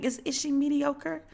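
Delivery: high, soft, hesitant query; doubtful questioning. Retold or imitated. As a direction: gently challenge the claim. Acting out her doubtful response.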